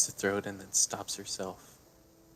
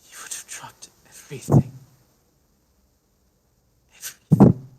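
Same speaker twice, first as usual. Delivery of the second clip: anguished; 4 semitones higher, 11 dB louder than usual. Acted. Grieving character's climax.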